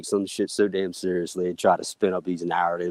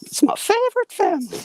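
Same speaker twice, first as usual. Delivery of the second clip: squeaky voice; 5 dB louder than usual. Acted. Squeaky put-on line.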